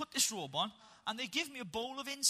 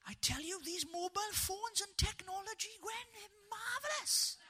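Bryn impression; 10 semitones higher, 5 dB quieter than usual. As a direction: play a delighted Bryn from Gavin and Stacey. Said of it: "a lovely impression of Bryn".

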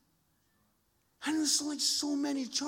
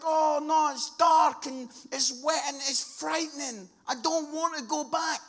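loud, higher-pitched reluctant protest; mock dread; 5 semitones higher, 9 dB louder than usual. Captain refusing to go back, acted dread. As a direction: play a captain balking at the danger.

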